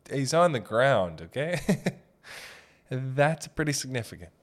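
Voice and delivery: normal talking voice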